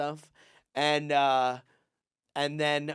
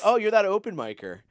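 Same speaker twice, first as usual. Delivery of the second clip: high, louder put-down; mock dismissive guy; 8 semitones higher, 6 dB louder than usual. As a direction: brush him off as a nobody. Voicing the guy's dismissive remark.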